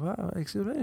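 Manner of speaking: high, quiet mock innocence; feigned surprise.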